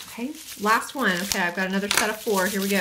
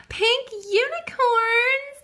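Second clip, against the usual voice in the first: high, slow, singsong Pink Unicorns; sing-song name; 4 dB louder, much slower than usual. Pretend. To her viewers, announcing the last polish with a high, slow singsong.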